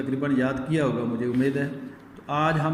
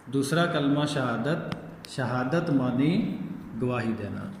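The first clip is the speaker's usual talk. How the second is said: slow recitation; 3 semitones lower than usual. Shown as a demonstration. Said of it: "شہادت کا معنی کیا ہے جی".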